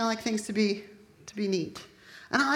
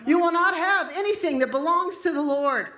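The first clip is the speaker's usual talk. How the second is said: rising, louder declaration against the enemy; preaching at full force; 4 semitones higher, 4 dB louder than usual. Acted. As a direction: command the darkness to back off.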